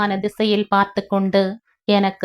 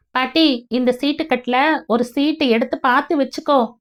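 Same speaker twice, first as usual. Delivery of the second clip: put-on higher, quicker little boy; a kid doing a card trick; child's voice; 5 semitones higher than usual. Boy showing grandma a card trick, child's voice.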